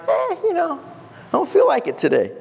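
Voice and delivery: playfully unmotivated; half-hearted shrug. Acted. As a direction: beg off with a noncommittal "eh". Imagined reluctance to evangelize.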